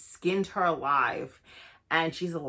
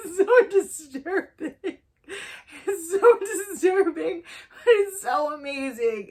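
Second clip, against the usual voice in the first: high, loud laughing squeal; cracking up in delighted horror; squealing giggle through the words; 10 semitones higher, 5 dB louder than usual. Real, not acted. Gleeful over gory horror scene.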